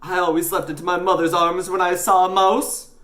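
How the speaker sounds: high, loud confession; nervous newsagent. Acted. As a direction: confess childish fear, rattled.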